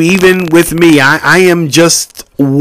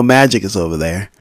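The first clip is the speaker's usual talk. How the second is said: ordinary talk, nothing marked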